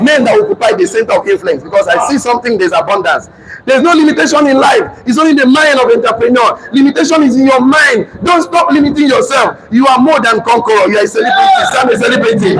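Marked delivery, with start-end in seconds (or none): 3.7-12.6 high, pushed motivational preaching. Acted. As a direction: preach it to lift the whole room. Preaching motivation at full force.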